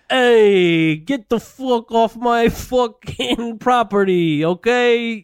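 put-on slow, high angry Italian-accented rant; an Italian-accented threat, drawn out; hammed-up furious accent over his shoes; angry Bobby Bonal. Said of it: "He's like".

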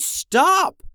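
high, loud scream to stop; panicked yell. A narrator's reading. Jackson yells at the monster, panicked shout. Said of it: "Jackson yelled".

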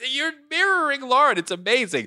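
high teasing singsong voice; high mock voice. Host teasing his wife at home, high mock voice. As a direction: pitch up in playful mockery.

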